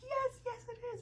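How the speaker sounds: high, eager agreement; high-pitched eager reply; excited yes. Retold or imitated. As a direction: blurt it out thrilled. Her own excited reply retold.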